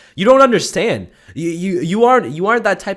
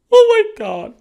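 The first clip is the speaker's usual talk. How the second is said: much higher joyful squeal; overjoyed; 8 dB louder, slower than usual. Acted. Fan hearing about the podcast, squealing with joy. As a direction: squeal it out in delight.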